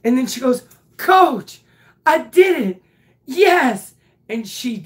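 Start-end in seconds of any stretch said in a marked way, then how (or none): 1.0-3.9 high, loud joyful announcement; proud excitement. Retold or imitated. Girl telling her coach she did it, excited. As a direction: burst with pride.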